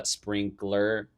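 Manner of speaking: SPRINKLER very slow, with a hard final R; hard American R. Shown as a demonstration. Explaining American accents, SPRINKLER with a hard final R. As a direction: stretch SPRINKLER out to a strong "er". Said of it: "Americans are real hard R with everything".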